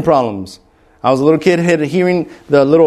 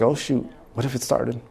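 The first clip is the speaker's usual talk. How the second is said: worried inner thought; 4 semitones lower, 11 dB quieter than usual. Retold or imitated. Anxious inner thought at test.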